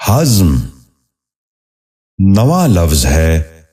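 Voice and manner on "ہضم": ہضم said as one syllable, "hazm"; correct pronunciation. Shown as a demonstration. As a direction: run z straight into m in ہضم. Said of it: "درست تلفظ ہے".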